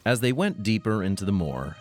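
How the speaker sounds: normal talking voice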